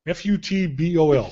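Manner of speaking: ordinary delivery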